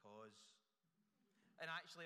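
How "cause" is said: mumbled low, soft "sorry" like a caught schoolboy; a hint of a sheepish kid, head down; sulky schoolboy. Caught schoolboy answering the teacher, mumbled low and soft. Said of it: "like the schoolboy who's been caught".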